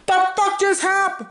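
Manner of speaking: sudden high-pitched shock; real startled yelp at a glitch; startled outburst. Real, not acted. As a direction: caught off guard, voice shoots up. Stream glitch surprises him.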